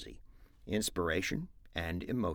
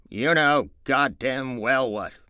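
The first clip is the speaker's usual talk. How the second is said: stern angry George; 6 semitones higher, 9 dB louder than usual. Acted. George to Lenny, angrily insisting on the mouse. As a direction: lean on every word.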